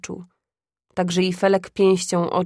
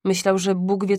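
ordinary delivery, nothing marked

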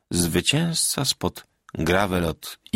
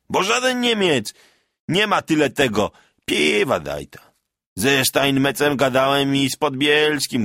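higher, rough-edged village bragging; boastful peasant soldier; 6 semitones higher, 3 dB louder, slower than usual. A narrator's reading. Veteran Bartek bragging to the villagers, boastful. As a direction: crow about beating the French.

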